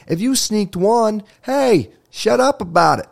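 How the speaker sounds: high, drawn-out comic exasperation; hammed-up high-pitched telling-off; mock scolding. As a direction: tell her off in disbelief. Mock scolding the chocolate thief.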